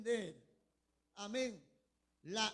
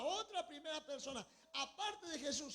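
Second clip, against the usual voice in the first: usual speaking voice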